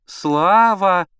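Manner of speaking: loud, high drawn call for a son; insistent motherly shout. A narrator's reading. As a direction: summon him from another room. Mother calling son from bedroom.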